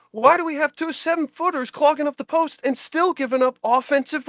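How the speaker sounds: high, exasperated rant at the coach. Retold or imitated. Fan at halftime railing at the coach on TV, high exasperated rant. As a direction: yell at the screen like a losing fan. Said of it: "I said".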